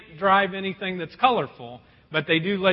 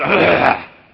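throat clearing; 3 semitones lower, 11 dB louder than usual. Retold or imitated. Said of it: "They all kinda cleared their throat first".

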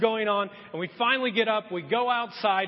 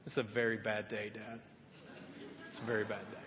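much lower and softer sad voice; sad little girl; 10 semitones lower, 14 dB quieter, faster than usual. Retold or imitated. Daughter telling her dad at the hamster grave, sad and soft. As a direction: say it heavy with grief.

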